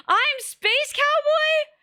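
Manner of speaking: slow high-pitched disbelief, words stretched wide; stunned Spike. Retold or imitated. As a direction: play the dumbfounded hero who just learned his own title. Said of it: "and you're like".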